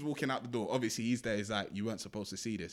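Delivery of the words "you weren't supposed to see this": low, soft killer's line; retold quiet menace. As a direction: drop the voice for a mock-ominous reveal. Killer catches witness in retelling.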